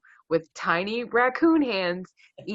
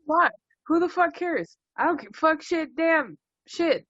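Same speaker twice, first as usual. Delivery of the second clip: hammed-up swearing spree, pitched way up; mock cursing outburst; 10 semitones higher than usual. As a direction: shout the curses up high for fun.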